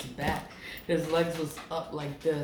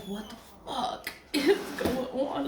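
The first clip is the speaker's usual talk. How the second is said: higher, half-laughing what-the-hell; baffled outburst; 7 semitones higher than usual. Retold or imitated. Confused by the spiritualists, laughing in disbelief. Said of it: "So I'm like".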